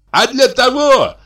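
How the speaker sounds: high, loud, drawn-out squeal. A narrator's reading. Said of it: "запищал Михей Егорыч". (пискливо, протяжно)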